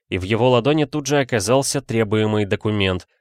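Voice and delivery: usual speaking voice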